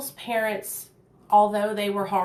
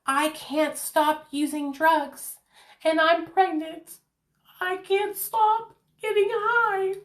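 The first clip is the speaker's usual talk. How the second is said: desperate inner plea; 7 semitones higher, slower than usual. Retold or imitated. In my heart.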